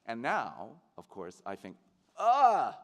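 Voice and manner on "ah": high, loud cry of delight; exultant "ah". Acted. Actor now celebrating his own identities, exultant cry. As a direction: burst out with a celebratory "ah".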